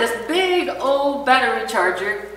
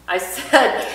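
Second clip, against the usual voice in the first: ordinary talk, nothing marked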